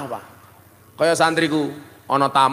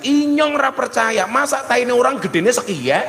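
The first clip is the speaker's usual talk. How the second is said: higher, hammed-up ngapak outrage; mock disbelieving shout; 8 semitones higher than usual. Ngapak man yelling at the Sundanese villager, mock shout. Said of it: "langsung teriak".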